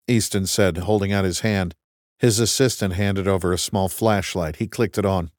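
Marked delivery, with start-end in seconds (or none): none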